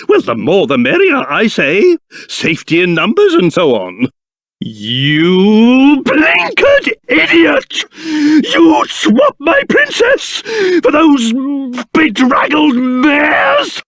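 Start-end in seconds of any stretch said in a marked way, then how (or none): 4.6-13.8 slow, high, spluttering indignation; theatrical outrage. Acted. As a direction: build up into drawn-out, high-pitched outrage. Outraged at a proposed swap.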